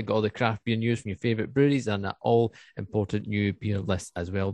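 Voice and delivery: ordinary talk, nothing marked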